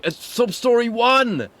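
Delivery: drawn-out hype call; excited announcer. Acted. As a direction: announce it like a big reveal.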